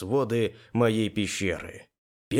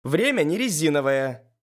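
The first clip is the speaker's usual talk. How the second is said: higher, impatient nagging voice; Elis, indignant; 5 semitones higher, 4 dB louder than usual. A narrator's reading. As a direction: press him impatiently, voice raised. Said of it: "ещё сильнее возмутилась Элис". (возмущённо поторапливая)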